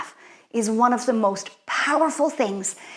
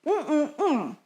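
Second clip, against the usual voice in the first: puffed-cheek hum; 4 semitones higher, 6 dB quieter than usual. Shown as a demonstration. Teacher demonstrating a puffer fish face, cheeks puffed and humming. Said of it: "make a face like a puffer fish".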